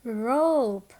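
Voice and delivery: "rope" stretched out for repeating; slow model of "rope" for the class to copy; long O in rope. Shown as a demonstration. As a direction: hold the O in "rope". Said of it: "Everyone say rope".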